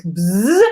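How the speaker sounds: short "bzz" blender noise; imitated blender buzz. Shown as a demonstration. Host blending in frozen strawberries, blender buzz.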